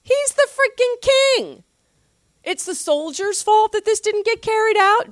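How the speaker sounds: can't believe what you're hearing; incredulous. Real, not acted. Pastor outraged by the king blaming soldiers, incredulous and high.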